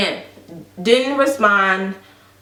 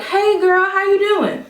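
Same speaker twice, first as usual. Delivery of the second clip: put-on high, drawn-out girlfriend hello; mock breezy greeting; 10 semitones higher than usual. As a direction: imitate a friend acting like nothing happened. Imitating friend's breezy greeting.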